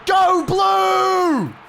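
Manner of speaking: high yell of a fan; cheering shout. Real, not acted. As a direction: cheer loudly for your team. Cheering his team in arena.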